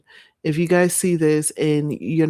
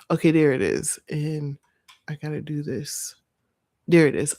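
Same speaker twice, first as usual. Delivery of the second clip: normal talking voice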